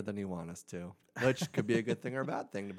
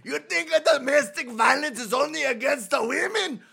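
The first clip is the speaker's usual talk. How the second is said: shouting Italian mobster, much higher; Italian mafioso accent; 9 dB louder than usual. Pretend. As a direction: yell it like a mob guy. Italian mafioso bit continues.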